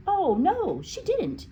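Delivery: put-on high piping child voice. Nigel eagerly defends Miss Honey, high piping voice. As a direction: pipe it up high like an eager boy. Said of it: "piped Nigel".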